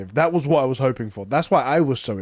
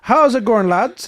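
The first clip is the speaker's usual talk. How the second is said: higher, louder hammed-up Irish accent; 10 semitones higher, 6 dB louder, slower than usual. Shown as a demonstration. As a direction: do a cheeky Irish lad greeting in an Irish accent. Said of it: "Do the Irish accent".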